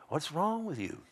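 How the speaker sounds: high, soft little voice for a saw; talking saw. Acted. Saw to its struggling user, high and soft. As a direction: squeak it softly as a complaining tool. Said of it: "that saw is going".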